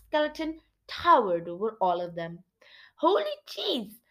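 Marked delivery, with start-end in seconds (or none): 3.0-4.0 high, drawn-out cry of awe; slow, high exclamation without extra volume; fearful exclamation. Acted. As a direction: exclaim as if about to faint, pitched high. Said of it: "exclaimed as he tried not to faint with fear".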